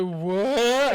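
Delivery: high, loud confused what; baffled what. Retold or imitated. Drunk caught in someone's jacket, bewildered. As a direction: blurt it out bewildered.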